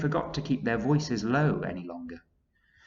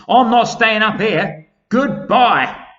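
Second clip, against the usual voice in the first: much higher, loud hurried goodbye; centipede fleeing; 12 semitones higher, 15 dB louder, slower than usual. Acted. Centipede to friends while fleeing, loud high voice. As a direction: bolt off declaring you're leaving.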